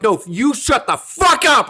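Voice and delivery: crowd yelling back. Retold or imitated. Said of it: "the crowd's like".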